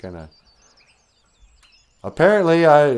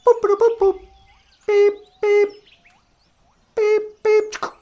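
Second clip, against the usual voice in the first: high beeping noises; 4 dB louder than usual. Pretend. Imitated phone or machine beeps.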